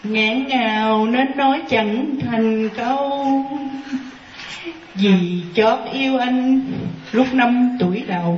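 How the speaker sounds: drawn-out poem recital, each word held; slow recited verse. Shown as a demonstration. Nun reciting her love poem to the master, slow and drawn out. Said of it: "recites own poem lines slowly".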